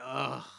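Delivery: low, soft groaning "ugh" sigh; collective sigh. Retold or imitated. Audience recognizing the old skit, groaning sigh. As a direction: let out a weary groan. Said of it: "it's like a collective sigh".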